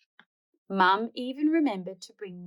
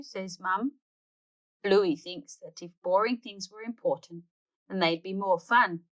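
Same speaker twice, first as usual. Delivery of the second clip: plain reading voice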